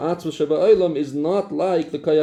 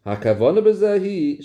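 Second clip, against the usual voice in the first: ordinary delivery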